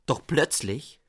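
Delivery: plain reading voice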